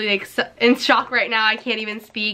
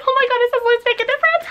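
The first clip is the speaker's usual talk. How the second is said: excited squeal; 13 semitones higher, much faster than usual. Real, not acted. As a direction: shriek it with pure joy. Reading the sunglasses case message.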